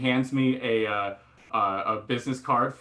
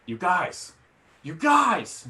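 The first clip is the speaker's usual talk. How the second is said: drug-addled voice climbing in pitch; drugged insistence; 6 semitones higher, much slower than usual. Retold or imitated. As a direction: climb higher each time, woozy and urgent. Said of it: "in my new drug-addled brain".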